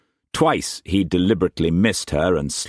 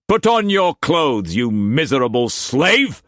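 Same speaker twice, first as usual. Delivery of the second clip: higher, louder raging master's voice; furious master; 8 semitones higher, 3 dB louder than usual. A narrator's reading. Struan raging at Mei Mei before whipping her, furious shout. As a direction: bark orders like an enraged lord.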